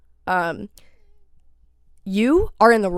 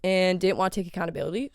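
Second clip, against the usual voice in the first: ordinary talk, nothing marked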